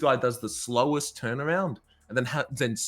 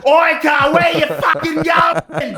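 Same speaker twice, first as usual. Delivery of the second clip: put-on drunk Aussie shout; 10 dB louder than usual. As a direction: bellow after the car like a drunk giant. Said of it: "He goes".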